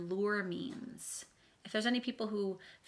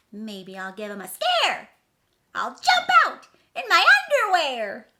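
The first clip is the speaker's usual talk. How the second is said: high, loud, sing-song rhyming read; dramatic storybook reading; 8 semitones higher, 13 dB louder, slower than usual. A narrator's reading. Reading the picture book to children, dramatically. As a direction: read the rhyme big for kids.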